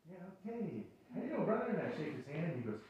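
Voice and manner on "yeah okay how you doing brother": soft, unsure return greeting; a visitor meekly returning an eager welcome; hesitant reply. Retold or imitated. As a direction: answer the greeting quietly, caught off guard. Pastor's own hesitant reply.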